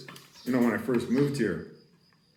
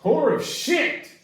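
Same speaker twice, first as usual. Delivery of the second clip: higher, louder angry swearing; stepped-up curse; 9 semitones higher, 4 dB louder than usual. Retold or imitated. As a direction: ramp up the frustration. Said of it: "you step it up to".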